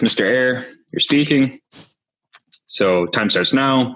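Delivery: ordinary talk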